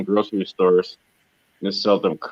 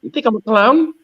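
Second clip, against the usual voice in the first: high, mocking tough-guy question; movie gangster impression; 11 semitones higher than usual. Mimicking a movie tough guy, pitched high. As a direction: needle them like a screen gangster. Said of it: "The actor".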